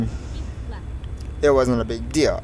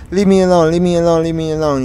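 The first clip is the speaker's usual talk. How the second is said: louder, higher mock protest, easing off as it repeats; retold upset protest; 4 semitones higher, 5 dB louder, faster than usual. Upset kid to a friend trying to talk, mildly insistent. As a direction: imitate an upset kid pushing someone away.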